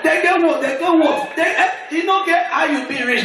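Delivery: comic shout. Acted. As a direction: hammer it to the crowd. Comedian building to punchline shout.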